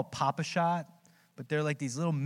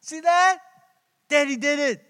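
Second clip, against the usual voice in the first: much higher and louder, crowing and teasing; gloating; 11 semitones higher, 6 dB louder than usual. Retold or imitated. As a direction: crow over the broken machine to your son. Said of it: "rubbing it in his face".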